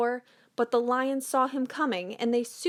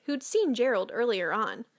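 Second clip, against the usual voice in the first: ordinary delivery, nothing marked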